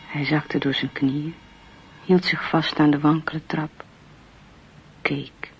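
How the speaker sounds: normal talking voice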